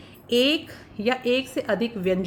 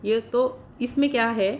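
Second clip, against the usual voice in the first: ordinary talk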